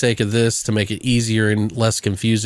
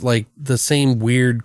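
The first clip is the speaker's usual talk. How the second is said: usual speaking voice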